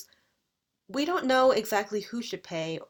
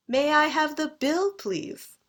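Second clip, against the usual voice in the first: 1 British intonation; 7 semitones higher, 3 dB louder, slower than usual. Shown as a demonstration. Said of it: "That's the British way".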